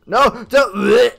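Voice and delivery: loud, high, urgent plea; alarmed protest. Real, not acted. Player reacting as food gets eaten, loud alarmed protest. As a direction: cry out to stop it.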